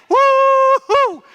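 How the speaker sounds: high, loud whoop; excited woo-hoo. Acted. Preacher cheering the temple's return, excited whoop. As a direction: let out a long cheering whoop. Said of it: "I'm getting excited again".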